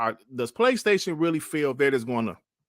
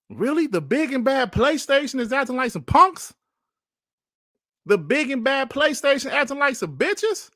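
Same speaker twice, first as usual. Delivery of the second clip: incredulous mockery; 8 semitones higher than usual. Real, not acted. Incredulous mocking of PlayStation.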